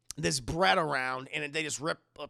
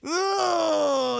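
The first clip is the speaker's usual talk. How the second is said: high, loud hammed-up sob; mock crying wail; 11 semitones higher, 8 dB louder than usual. Mother breaking into a hammed-up crying wail at dinner. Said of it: "starts fucking crying again".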